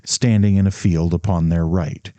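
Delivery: ordinary delivery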